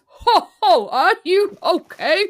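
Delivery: high, slow chuckling elf greeting; a jolly little helper asking kindly, high and laughing. Acted. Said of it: "there is another voice that says".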